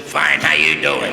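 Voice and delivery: slow disguised voice; mock stranger's voice; put-on slow voice. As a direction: answer in a slowed-down put-on voice. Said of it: "I have to talk like this".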